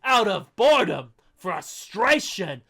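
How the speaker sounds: theatrical critic reading with mounting outrage; mock dramatic review reading. Podcaster reading a viewer's harsh review, theatrical. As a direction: read the scathing review like a pompous critic.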